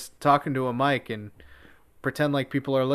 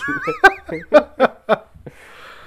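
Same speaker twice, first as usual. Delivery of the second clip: loud laugh; 10 dB louder than usual. Real, not acted. Laughing at sock puppet joke.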